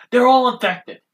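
high, loud hammed-up accusation; retold furious shout. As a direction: imitate a panicked accuser shouting. Retold asshole yelling back in fury.

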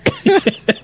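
a short high-pitched laugh. Real, not acted.

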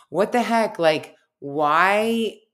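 indignant, retelling her own anger; exasperated outburst. Retold or imitated. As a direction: let it burst out in disbelief. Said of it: "I remember being so angry".

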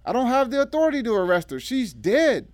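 high, loud, fast protest; heated retort. A narrator's reading. Lieutenant refusing the D A's order to arrest, retorting. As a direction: fire back in exasperated protest. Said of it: "the lieutenant retorted".

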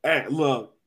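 ordinary delivery

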